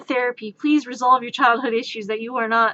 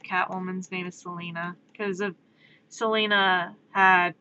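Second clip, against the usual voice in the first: ordinary talk, nothing marked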